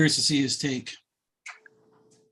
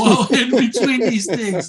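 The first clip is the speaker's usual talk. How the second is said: laughing through the words; slow, high words through a laugh; 6 semitones higher, 6 dB louder, slower than usual. Real, not acted. Joking about fans dying.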